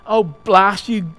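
loud, exasperated bellow. A narrator's reading. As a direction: roar it out as the fed-up ghost. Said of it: "bellowed the ghost".

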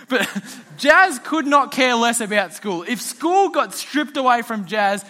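ordinary talk

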